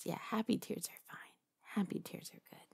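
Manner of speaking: whispered soothing. Acted. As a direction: hush them, barely voiced. Soothing crying captive.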